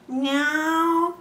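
high, plaintive miaow; whining meow. A narrator's reading. The cat whines to Bloom for milk, high plaintive meow. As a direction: mew like a cat begging for milk. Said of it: "piagnucolò la gatta".

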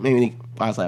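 usual speaking voice

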